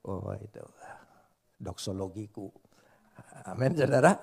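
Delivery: normal talking voice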